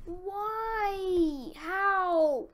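high, loud, drawn-out wail; frustrated wail. Real, not acted. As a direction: wail in exasperation, stretching each word. Frustrated at failing in game.

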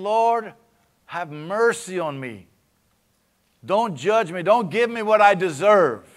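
loud, high begging cry; desperate plea. Acted. David begs God not to judge him, loud high plea. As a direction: cry out for mercy.

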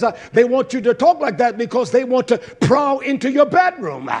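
loud, high, fervent preaching; preaching at full force. Real, not acted. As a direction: thunder it from the pulpit.

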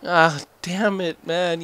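loud, slowed annoyed curse; frustrated cursing. Real, not acted. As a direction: vent at the game after a failure. Failed jump in game.

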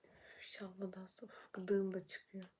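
unvoiced whisper under her breath. Real, not acted. Girl doing makeup on camera whispers to herself. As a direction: whisper it to yourself while concentrating.

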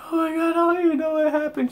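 hammed-up squeaky meltdown; mock freaking out. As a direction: imitate a hysterical girl babbling to a cop. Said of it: "She's, like, freaking out".

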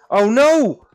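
real panic, shrieking no over and over; panicked no. Real, not acted. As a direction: scream it as you're about to die. Panicking as mob attacks.

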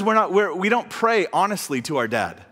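ordinary talk, nothing marked

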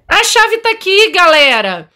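high, loud excited cry; thrilled at finding the key. Real, not acted. Finds the key.

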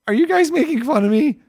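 high, wounded whine; mock hurt. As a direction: squeak it out as if picked on. Mock hurt at viewers' teasing.

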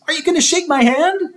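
put-on fast high child voice; high eager kid. A narrator's reading. John to Lewis, a fast high kid voice. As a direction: push him for a handshake.

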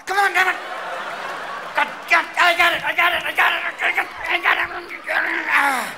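high, loud, strained panic; frantic struggling. Acted. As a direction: babble desperately while fumbling. Frantic struggle putting on a condom.

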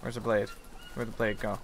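normal talking voice

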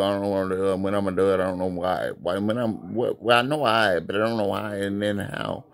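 a hint of a dazed tough guy ranting; confused low voice. Deep voice rants confused.

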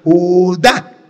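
"Judá" split, DÁ punched and rising; stress on final A. Shown as a demonstration. Priest teaching his uncle to read, emphatic final stress. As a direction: land hard on the accented A of "Judá". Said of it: "tiene acento en la A".

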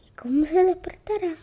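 whispering. Acted.

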